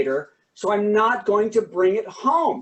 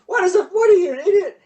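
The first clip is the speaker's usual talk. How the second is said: mock exasperation; 11 semitones higher, 4 dB louder, faster than usual. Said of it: "people are like".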